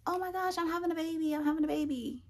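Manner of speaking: high, squealed mock-excited gushing; mock excitement. Telling viewers what her reaction wasn't like, mock excitement. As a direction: gush it high, like happy news just landed.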